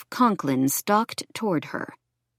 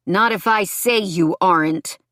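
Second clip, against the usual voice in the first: louder, mildly growled threat; 3 semitones higher, 4 dB louder than usual. A narrator's reading. Ex-boyfriend contradicts her, mildly growled. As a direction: push it out with a touch of growl. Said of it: "He growled low in his throat".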